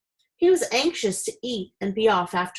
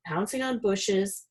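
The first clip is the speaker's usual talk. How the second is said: usual speaking voice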